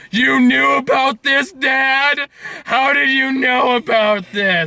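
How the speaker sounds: slow, whiny complaining voice; a hint of a whiny Hellboy; mock whiny son. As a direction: whine at Dad like a sulky kid. Mocking Hellboy whining at Dad.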